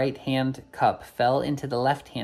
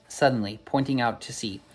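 usual speaking voice

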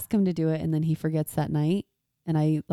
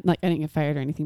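ordinary talk

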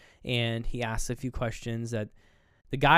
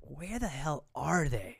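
slow, softer drawn-out question; baffled; 3 semitones higher, 5 dB quieter, slower than usual. Retold or imitated. Viewer puzzling over the underground place, baffled. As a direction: wonder it aloud in exaggerated puzzlement.

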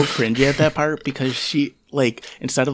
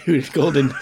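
normal talking voice

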